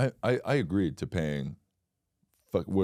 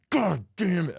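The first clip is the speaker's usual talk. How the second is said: frustrated curse; 10 semitones higher than usual. Pretend. Finding Chipotle closed, frustrated curse. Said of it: "I'm like".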